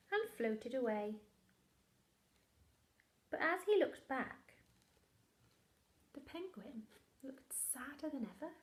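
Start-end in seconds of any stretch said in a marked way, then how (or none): 6.1-8.5 whisper. Acted. Sad moment told in hush.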